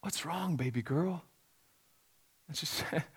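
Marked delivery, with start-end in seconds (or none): 0.0-1.2 tender. Retold or imitated. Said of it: "I said".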